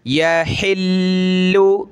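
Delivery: यहिल्लु with the doubled ल held and stressed; tajweed recitation. Shown as a demonstration. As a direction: stretch out यहिल्लु slowly, pressing on the double L. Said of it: "ये तशदीद हो, ... सख्त करते हैं". (यहिल्लु धीरे, लाम पर ज़ोर देकर)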